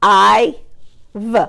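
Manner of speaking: "I-v" with I held and V separate; chopped word. Shown as a demonstration. Teacher chopping a word for pupils, sounds split apart. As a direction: chop HIVE sound by sound. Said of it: "let's chop".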